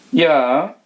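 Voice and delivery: the rat answers solemnly. Acted. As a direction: answer with ceremony. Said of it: "sprach die Ratte feierlich".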